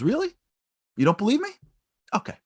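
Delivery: amused surprise. Retold or imitated. Buffett surprised at disbelief.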